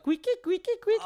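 high squeaky clown shoe squeaks. Retold or imitated. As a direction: squeak like giant shoes stomping away. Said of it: "'Cause I had giant shoes on".

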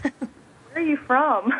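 slow, drawn-out mock question; puzzled Subway clerk. Subway guy confused by her Louisiana accent, slow puzzled question. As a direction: stretch it out like a baffled clerk. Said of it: "He's like".

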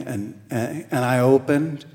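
usual speaking voice

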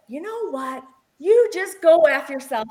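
telling off the partner, loud and furious; angry yell. Retold or imitated. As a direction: fling it at him like the brush. Retold blow-up at partner.

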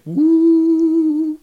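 high, wavering theremin sound; weird theremin woo. Shown as a demonstration. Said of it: "like, weird, like".

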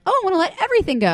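mock giddy excitement. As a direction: gush it out like you're suddenly hooked on purging. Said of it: "and you're like".

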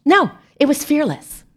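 high mock-admiring fearless; fearless, said high in mock awe; mock proud. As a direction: praise the rat's bravery with mock awe. Mock-proud answer for the rat.

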